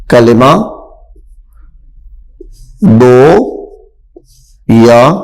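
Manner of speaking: word by word with long pauses; slow dictation. Shown as a demonstration. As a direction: dictate each word for the class to repeat. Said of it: "دو یا دو سے زیادہ الفاظ سے مل کے بنتا ہے۔". (لفظ بہ لفظ آہستہ)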